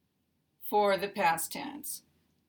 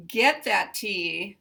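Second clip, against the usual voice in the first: ordinary talk, nothing marked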